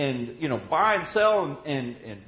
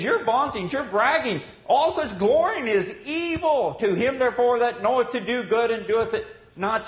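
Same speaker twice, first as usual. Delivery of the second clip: loud, high preaching voice, building; rising sermon fervor; fervent preaching; 5 semitones higher, 4 dB louder than usual. Acted. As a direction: preach it out with fire. Preaching at full force.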